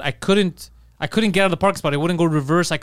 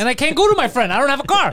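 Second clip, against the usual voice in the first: pitched up high and loud in disbelief; exasperated outburst; 8 semitones higher, 4 dB louder than usual. Real, not acted. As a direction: voice jumps up, fed up and incredulous. Frustrated rant to friend.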